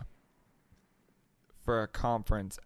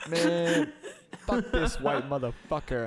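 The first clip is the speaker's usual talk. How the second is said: high, laughing mock yell of the stranger; angry street guy; 6 semitones higher, slower than usual. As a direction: play the ranting guy again, half-laughing. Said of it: "And he's just like".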